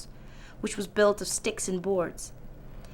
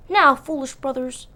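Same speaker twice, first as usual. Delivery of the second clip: scolding pig; 5 semitones higher, 5 dB louder than usual. A narrator's reading. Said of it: "said the wise little pig".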